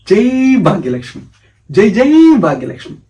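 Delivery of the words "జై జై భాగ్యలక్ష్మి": loud, high rallying hail; devotional cheer. Acted. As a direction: cry out the praise to the goddess. Rousing hail repeated to the goddess.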